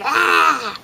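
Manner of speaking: put-on short high "Ah!" like an upset toddler; toddler's frustrated cry. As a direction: blurt it out like a little girl who has no words. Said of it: "just starting to be like".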